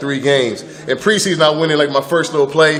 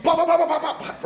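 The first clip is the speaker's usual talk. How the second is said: chanted pops; 13 semitones higher, 6 dB quieter than usual. Retold or imitated. Footwork sound effects.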